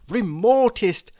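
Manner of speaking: ordinary delivery, nothing marked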